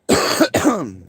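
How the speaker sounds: harsh chesty cough, real; hacking cough. Real, not acted. Streamer alone on camera coughing from pipe smoke, harsh hacking cough.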